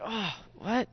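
soft, dazed, confused; like stumbling out of sleep; groggy just-woken. Retold or imitated. Disciples startled awake in the garden, dazed.

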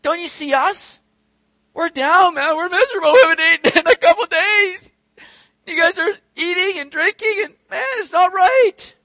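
high, loud, whining protest; whiny complaining Pharisees. Acted. Pharisees complaining to the feasting disciples, high and whiny. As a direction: whine like hungry sulking Pharisees. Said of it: "where they're saying".

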